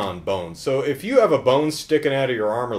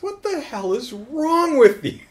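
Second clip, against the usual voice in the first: high, drawn-out mock outrage; exasperated disbelief; 14 semitones higher, 3 dB louder than usual. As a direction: wail it slowly in disbelief. Acts out exasperated cornered man.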